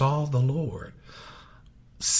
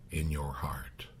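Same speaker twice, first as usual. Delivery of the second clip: whisper; 5 semitones lower, 12 dB quieter than usual. Real, not acted. Preacher pressing a solemn point.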